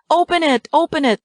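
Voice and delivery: put-on very high, eager children shouting; excited shout. A narrator's reading. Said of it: "they shout".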